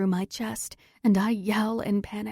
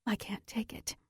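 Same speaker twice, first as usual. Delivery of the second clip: breathy broken whisper; 11 dB quieter than usual. Acted. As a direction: whisper it in despair. Despairing, whispered.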